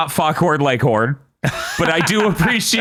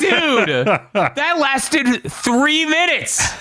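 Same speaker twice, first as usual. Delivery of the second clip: high, drawn-out mock disbelief; exasperated, high-pitched comic objection; incredulous retort; 9 semitones higher, much slower than usual. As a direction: protest it slowly, on the edge of laughter. Mock incredulous retort to film character.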